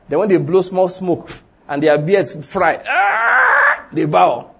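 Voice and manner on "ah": mock pained cry. Beards on fire in furnace.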